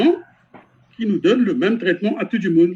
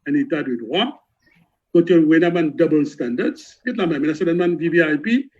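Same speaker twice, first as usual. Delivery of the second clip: ordinary delivery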